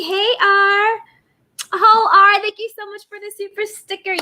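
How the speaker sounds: high sing-song thank-you; excited thanks. Real, not acted. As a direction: gush over the sticker.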